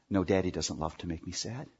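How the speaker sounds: lightly voiced child answering daddy; child's reply. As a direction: mumble it back like a small child.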